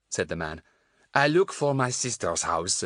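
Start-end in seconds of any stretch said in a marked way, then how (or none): 1.1-2.8 put-on raised French-accented English. A narrator's reading. Lost man explaining himself to children, French accent. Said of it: "suddenly going very French".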